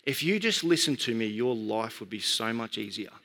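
low, softened private thought; inner voice. Retold or imitated. Father silently addressing his daughter, low inner voice. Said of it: "in my head, I said these words".

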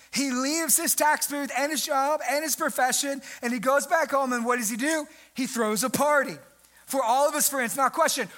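ordinary delivery

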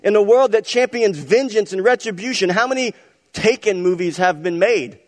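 high, loud pulpit delivery; full-force preaching. Acted. Pastor rousing the church about payback movies, loud preaching. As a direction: preach it out big to the congregation.

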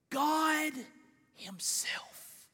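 falling away into a whisper; hushed, breath-only ending; awed hush. Real, not acted. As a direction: let the last word drop to a reverent whisper. Climax drops to a hush.

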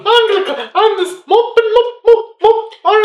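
put-on very high falsetto shop girl, laughing; high squeaky hammed-up maid voice; Cheryl voice. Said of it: "I'm Cheryl".

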